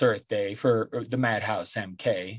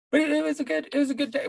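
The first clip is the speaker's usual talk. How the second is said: high silly voice; faster than usual. Acted. Sudden high voice.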